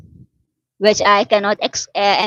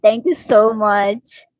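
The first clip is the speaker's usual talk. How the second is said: ordinary delivery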